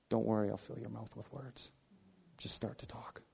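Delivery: calm him from within, hushed; soft inner voice. Retold or imitated. Holy Spirit tells pastor not to worry, whispered.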